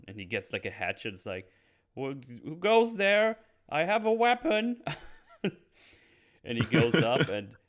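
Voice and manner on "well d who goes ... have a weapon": high, loud scared Geppetto impression; scared old man. Geppetto hearing footsteps, mock frightened high voice.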